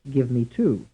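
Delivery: "to" stressed in "give me to"; stress on third syllable. Shown as a demonstration. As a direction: land on final "to". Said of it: "stressed on the third syllable".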